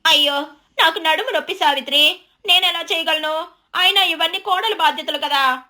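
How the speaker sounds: high, whiny neighbour making excuses; reluctant, grimacing. Acted. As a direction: back out of the chore with a grimace. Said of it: "Durgamma మొహం చిట్లించుకొని".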